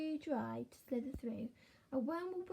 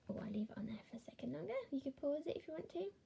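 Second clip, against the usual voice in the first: soft aside; 3 semitones higher, 7 dB quieter, faster than usual. Real, not acted. Aside to child viewers.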